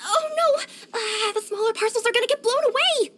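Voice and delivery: high-pitched worried cry; alarmed. Acted. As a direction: fret in a panic, voice pitched well up. Wind threatening the parcels.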